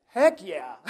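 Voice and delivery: eager reply. Retold or imitated. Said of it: "He's like".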